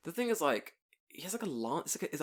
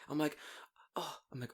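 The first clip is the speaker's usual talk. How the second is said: ordinary talk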